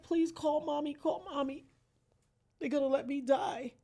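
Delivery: tearful pleading. Retold or imitated. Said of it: "bawling and screaming and crying".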